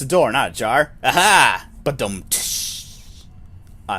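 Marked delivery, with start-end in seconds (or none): 1.0-1.7 mock triumph. Reader celebrating their own door pun, mock triumphant. 1.8-3.2 spoken drum sting with a long cymbal tsh; imitated rimshot. Reader capping a bad pun, drawn-out mock rimshot.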